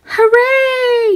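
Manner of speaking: high cheering shout; shouting hooray. A narrator's reading. Twins cheering after winning the ribbon, shouting. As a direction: shout it together with joy. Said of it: "shouted Carlos and Carmen".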